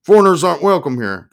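loud, high-pitched warning; shouted. A narrator's reading. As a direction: keep shouting them away. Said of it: "I shouted".

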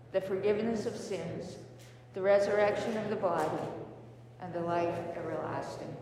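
low, measured congregational recital; creed recitation. Real, not acted. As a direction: recite the creed in even, paused phrases.

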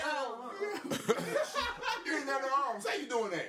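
high, laughing incredulous imitation; mock outraged woman. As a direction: act out her shocked disbelief, cracking up. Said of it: "She's like".

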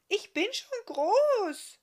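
put-on very high, slow, loud child's protest; indignant little girl. Little Agi protesting she is big, high and indignant. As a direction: protest like an offended small child. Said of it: "schimpfte ein Stimmchen".

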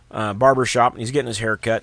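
usual speaking voice

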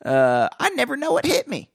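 high, laughing squeaky riff; squeaky joke voice. Pretend. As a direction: keep the silly squeak going.

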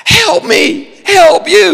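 very high, loud, slow begging; mock desperate plea on his knees; half-laughing, half-crying mock plea; hammed-up pleading. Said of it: "I'm gonna stop doing that now".

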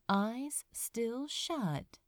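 usual speaking voice

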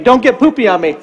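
ordinary talk

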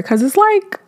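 ordinary delivery

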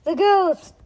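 high, loud exclamation of fright; frightened cry. A narrator's reading. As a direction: cry out in alarm. Frightened girl sees the creature.

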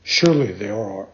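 low, deep, calm sea creature; dolphin voice. Acted. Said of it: "answered the dolphin".

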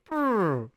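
helicopter noise. Retold or imitated. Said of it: "the helicopter going".

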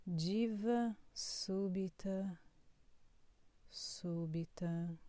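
drawn-out, savoring each word; slow. Acted. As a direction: stretch the words slowly. Poetic slow repetition.

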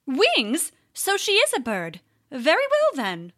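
high, lightly voiced old owl. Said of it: "the old owl said".

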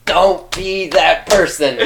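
slow, high, loud scolding tease; teasing scold. Real, not acted. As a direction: drag it out in mock scolding.

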